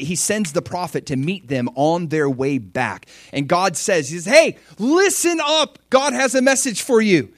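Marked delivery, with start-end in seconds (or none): 4.3-7.3 excited summons. Acted. Prophet meeting the army on its way back, excited. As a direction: call out with eager urgency. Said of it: "like, God is excited".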